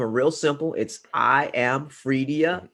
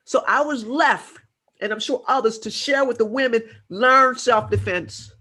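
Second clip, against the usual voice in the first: impassioned; 6 semitones higher than usual. Real, not acted. Impassioned warning to women.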